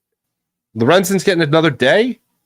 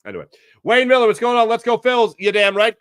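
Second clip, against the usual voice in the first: normal talking voice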